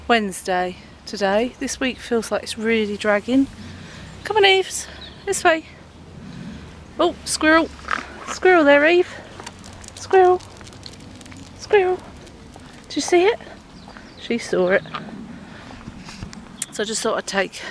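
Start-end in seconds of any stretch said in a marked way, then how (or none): 4.2-5.6 high-pitched pet talk; calling the dog. Real, not acted. Owner calls Eve along on a walk, high pet-calling voice. 7.0-13.4 high, eager pet voice; excited dog talk. Real, not acted. Owner shows the dog a squirrel, high excited calls.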